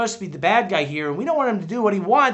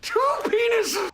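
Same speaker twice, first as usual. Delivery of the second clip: shouted; slower than usual. Acted. Shouted punchline.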